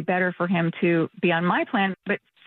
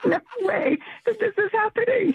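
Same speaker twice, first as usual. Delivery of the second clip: laughing in disbelief; 12 semitones higher than usual. Real, not acted. Customer stunned by the rude bots.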